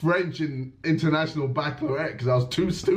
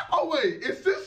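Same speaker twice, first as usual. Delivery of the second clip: normal talking voice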